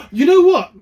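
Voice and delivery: gasping with sudden recognition; sudden surprise. Real, not acted. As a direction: blurt it out as it hits you. Sudden realisation, remembers guest.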